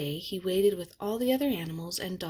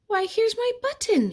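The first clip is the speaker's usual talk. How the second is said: high, loud happy cry; a bear's delighted cry; excited cry; 7 dB louder than usual. A narrator's reading. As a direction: shout with joy at finding it. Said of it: "he cried".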